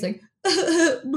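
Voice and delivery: mock bawling broken by blubbing; imitated face-dunking sobs. Storyteller reenacting the icy face dunk, spluttering mock sobs. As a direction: act out sobbing while being dunked. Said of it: "dunking my face".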